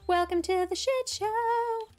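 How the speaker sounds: high, slow, hammed-up announcer lilt; mock grand welcome. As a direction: sell the chaos like a showman.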